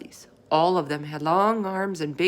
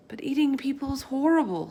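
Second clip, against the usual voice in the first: put-on higher, horrified girl's cry; Sophie crying out; 8 semitones higher than usual. Sophie crying out to the giant in horror. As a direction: burst out in horror as a girl. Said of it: "Sophie cried".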